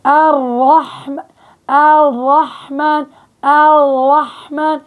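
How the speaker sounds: الرحمن slow with the tongue curled back on ra. Retold or imitated. Teacher imitating sisters who curl their tongue. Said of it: "بلفوا لسانهم بالراء".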